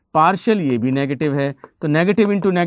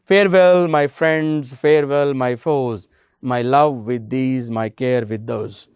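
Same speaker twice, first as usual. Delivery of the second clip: slow poem reading; 3 semitones lower, much slower than usual. Acted. Teacher reciting a poem to students, very slow. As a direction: recite it like verse to a class. Reads poem lines to class.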